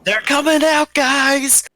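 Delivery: high, loud shout to the party; shouting warning. Acted. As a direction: call out the alarm. Warning party that enemies emerge.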